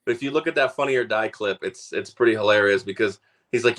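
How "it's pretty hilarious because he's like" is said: ordinary talk, nothing marked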